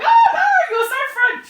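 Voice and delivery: very high, loud, squealing shout; mock delighted squeal. Teachers tease the English mother, loud high squeal. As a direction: imitate teachers shrieking with delight. Retells teachers laughing at her.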